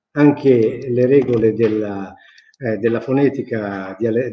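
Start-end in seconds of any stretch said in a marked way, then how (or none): none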